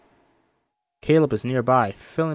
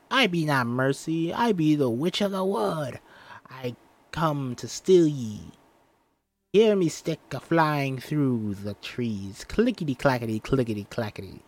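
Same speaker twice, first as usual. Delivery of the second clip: scratchy mock-witch voice; old scratchy voice; 4 semitones higher, 4 dB louder than usual. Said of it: "pretending to be old and scratchy".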